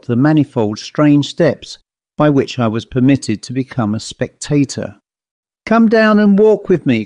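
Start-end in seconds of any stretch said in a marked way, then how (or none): 5.7-7.0 put-on raised-pitch cry of a young fellow; calling out. A narrator's reading. Young fellow to the girl on the hay wagon, calling out. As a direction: call out an eager invitation, pitched well up. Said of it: "cried the young fellow".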